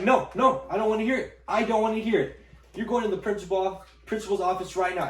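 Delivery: put-on high, loud shouting that cuts the kid off; furious teacher. Teacher sending student to the principal, loud furious shout. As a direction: shout down every excuse as an enraged teacher.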